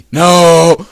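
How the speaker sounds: loud high shout. Real, not acted. Shouted reaction.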